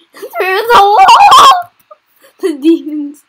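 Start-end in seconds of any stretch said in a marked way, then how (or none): 0.1-1.7 slow, high, loud wail; panicked cry. Real, not acted. Watching demons pull her character through the wall, panicked wail. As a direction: drag it out in panic.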